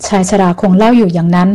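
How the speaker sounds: ordinary delivery, nothing marked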